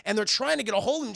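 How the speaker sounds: ordinary talk, nothing marked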